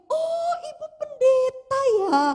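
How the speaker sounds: high, drawn-out delighted realisation; imitated "oh, so you are" surprise; surprised recognition. Retold woman's surprised recognition.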